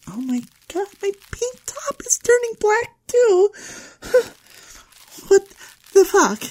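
very high, whining tearful wail; distraught panic. Acted. Girl losing her pink top and tan, distraught. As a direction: cry out in mounting horror.

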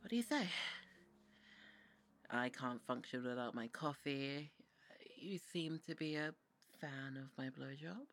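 snooty brat, demanding and teasing; bitchy bratty voice. Acted. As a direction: sneer every order like a spoiled brat. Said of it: "I was doing a bitchy, bratty voice".

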